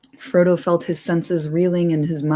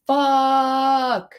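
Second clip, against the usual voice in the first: mock werewolf yell; 8 semitones higher than usual. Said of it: "a werewolf just yelling".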